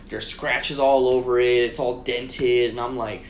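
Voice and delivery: normal talking voice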